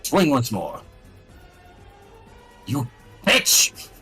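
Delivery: usual speaking voice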